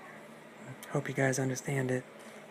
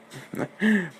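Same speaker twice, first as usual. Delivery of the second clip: high, loud gloating wolf laugh; evil laugh; 5 semitones higher, 5 dB louder than usual. Acted. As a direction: cackle over your victim. Big bad wolf gloats.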